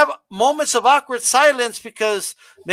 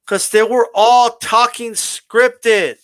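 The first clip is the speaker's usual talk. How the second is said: stiff scripted promo talk; slower than usual. Shown as a demonstration. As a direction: drag out each phrase like a bad wrestling promo read. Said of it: "like this".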